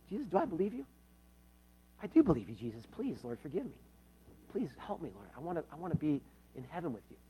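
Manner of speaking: soft, quick, pleading words to Jesus; hushed pleading prayer. Acted. Sinner quietly begging Jesus for forgiveness, hushed. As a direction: pray it quietly, almost under the breath.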